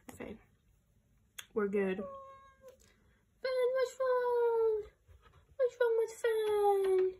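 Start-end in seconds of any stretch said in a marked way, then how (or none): 2.0-7.1 very high, louder sing-song pet voice; high worried pet talk. Real, not acted. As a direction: croon high at the upset pet. Talking to the crying pet.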